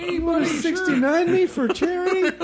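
mock phone call.

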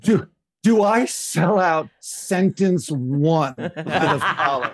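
mock agonised, half laughing; laughing dilemma. Intern asked about Imelda Marcos, slow laughing dilemma. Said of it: "And I'm like".

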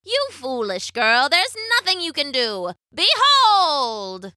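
drawn-out high crone voice; scold, then proclaim grandly; theatrical witch building to a cry; scornful witch. A narrator's reading.

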